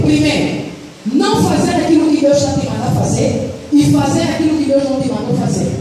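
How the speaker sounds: ordinary talk, nothing marked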